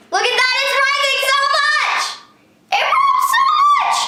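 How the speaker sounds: high, loud squealing delight; excited squeal. Real, not acted. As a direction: shriek it out with thrilled laughter. Slime rising fast, squealing.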